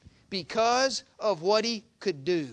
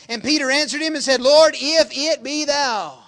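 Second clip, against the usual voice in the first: raised, loud scripture reading; full-force preaching; 5 semitones higher, 10 dB louder than usual. Acted. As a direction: proclaim the verse, stretching out the plea.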